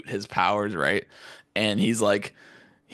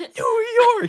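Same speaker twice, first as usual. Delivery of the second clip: very high, strained imitation of an anime hero; mock battle cry; 5 dB louder than usual. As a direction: call out each name like an attack. Imitating Deku shouting state attacks.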